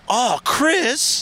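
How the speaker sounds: high, mock-scandalised cry; mock shock. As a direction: gasp it out in fake outrage.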